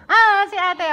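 high, loud delighted cry; excited call-out. Real, not acted. Vlogger spotting a coworker, loud delighted call. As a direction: shout her name like you just spotted a friend.

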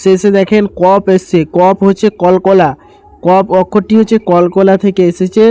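normal talking voice

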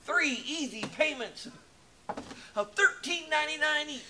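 high, upbeat infomercial pitch; home shopping host. Pretend. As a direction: sell it like a late-night TV ad. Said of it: "make an infomercial of it".

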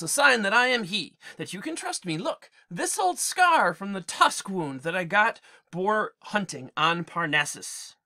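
returning king, lightly voiced, firm and warm; a hint of a proud hero; Odysseus promising rewards to his herdsmen; Odysseus revealing himself.

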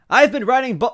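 loud, high character outburst; crying out. Acted. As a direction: cry it out in indignation. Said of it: "Wendy cried out".

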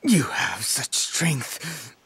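slow, soft unvoiced whisper. Villain whispering mockery to Sora, hushed. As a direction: breathe it out slow as a sly whisper.

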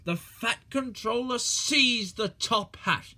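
usual speaking voice

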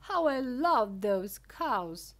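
loud, slow gushing; adoring exclamation. A narrator's reading. Wonka gushing over his cows, slow, loud delight.

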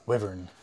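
wyvern with a short i, WIV-ern; short-i pronunciation. Retold or imitated. Quoting how another player says it, wyvern with short i. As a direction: rhyme the start of "wyvern" with "give". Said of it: "Tavis King calls it".

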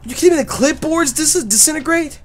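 very high incredulous outburst; high-pitched indignant questioning; outraged disbelief. Real, not acted. Clipboard vanishes, outraged disbelief.

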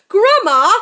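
loud, high panicked cry. Acted. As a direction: cry out in terror. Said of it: "he cried out".

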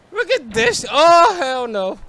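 high, then slow drawn-out dread; scared disbelief. Real, not acted. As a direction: recoil from something creepy. Creepy witch scene in game.

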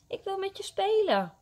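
put-on high pleading little-boy voice; pleading child. A narrator's reading. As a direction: coax a playmate to stay. Child pleads with cat to stay.

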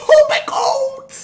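high, drawn-out shriek of surprise; sudden high-pitched oh my god; shocked scream. Real, not acted. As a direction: shriek it out, stretched and loud.

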